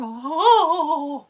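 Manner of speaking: high, loud, drawn-out howl; mock nightmare wail. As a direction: cry out as if reliving the nightmare. Nightmare flashback of the tram.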